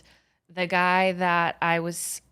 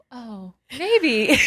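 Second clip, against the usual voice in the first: higher, louder, mock-coy "Oh, maybe"; sheepish coy reply; 6 semitones higher, 6 dB louder than usual. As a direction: play it like a coy answer to your date. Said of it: "and I was like".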